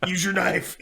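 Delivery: lightly voiced suffering deer, a bit urgent; talking deer. Injured deer begs the driver to finish it off, mock pleading. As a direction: play a wounded deer pleading, half-joking. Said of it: "then the deer's like".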